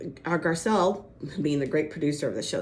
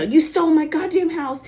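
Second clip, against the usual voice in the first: mock furious yell, high and loud; retold angry outburst; 10 semitones higher, 8 dB louder than usual. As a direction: imitate an explosive accusation. Retold furious accusation at sister.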